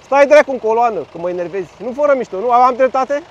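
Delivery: annoyed, heated outburst in traffic; real irritation. Real, not acted. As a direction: tell them off loudly from the saddle. Că mă enervezi.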